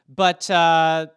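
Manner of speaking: ordinary talk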